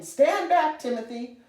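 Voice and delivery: loud, high, urgent order; shouted warning. A narrator's reading. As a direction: shout to clear the way. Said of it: "waving a broom and shouting".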